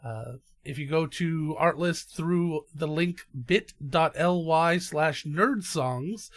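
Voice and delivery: upbeat sponsor ad read. Acted. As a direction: pitch the sponsor to listeners. Reads the sponsor ad.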